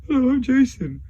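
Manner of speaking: high, sugary overfriendly voice; sweet fake-friendly persona; friendly alter ego. Shown as a demonstration. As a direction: play the nice side of a split personality. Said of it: "Like, this is".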